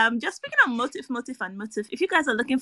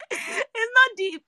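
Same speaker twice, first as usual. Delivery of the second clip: high, slow, squeaky with laughter; laughing repeat; much slower than usual. Real, not acted. Host dismissing the film as trivial, laughing repeat. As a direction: drag it out while cracking up.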